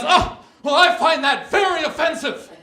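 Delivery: high, slow, prissy offended voice; mock-offended bystander, high and slow; offended stranger. Offended guy at the market.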